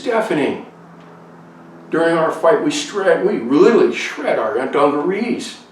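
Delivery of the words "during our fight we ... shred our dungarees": excited. Real, not acted. Excited climax of the story.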